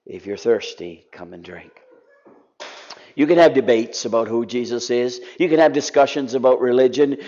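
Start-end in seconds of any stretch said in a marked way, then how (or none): 0.0-1.7 low, hushed, intimate invitation; quiet declaration. Acted. Jesus at the feast to the crowds, hushed and gentle. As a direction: lean in and offer it softly. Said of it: "he declares to them all".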